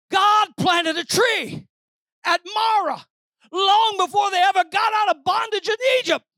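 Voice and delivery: high, loud proclamation; preaching at full force. Real, not acted. Preacher proclaiming God's plan at Marah, full-force preaching. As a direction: ring it out to the whole congregation.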